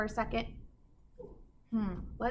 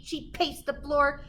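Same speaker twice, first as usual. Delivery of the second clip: ordinary delivery, nothing marked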